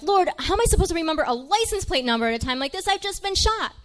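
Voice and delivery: fast, high-pitched plea to the Lord; hammed-up indignant protest, rushed; exasperated prayer. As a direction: argue with God in comic disbelief. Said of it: "start praying again".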